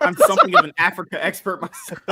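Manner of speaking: mock quote through laughter; laughing quote. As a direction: quote him while laughing. Quoting the guest mockingly.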